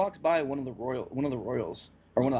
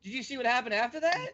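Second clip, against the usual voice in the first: excited; 8 semitones higher, faster than usual. Real, not acted. Excited about the anime scene.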